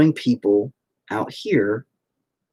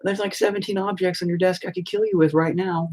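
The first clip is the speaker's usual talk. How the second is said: higher, matter-of-fact retelling; patient telling therapist a blunt fact; quoting himself to therapist; 8 semitones higher than usual. Retold or imitated. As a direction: casually tell the therapist a dark truth. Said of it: "when you tell your therapist".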